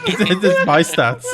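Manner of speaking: laughing through the words; giggling disbelief. Real, not acted. Laughing at character sheet.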